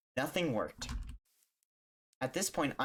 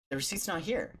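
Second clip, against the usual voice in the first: quoting the clerk; 7 semitones higher than usual. Retold or imitated. Clerk reports missing receipt.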